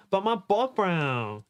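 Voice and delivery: high, plaintive objection; whiny protest. Acted. Heroine objects to being saved, high whiny protest. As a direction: whine it like a lovesick girl. Heroine whines about her boyfriend.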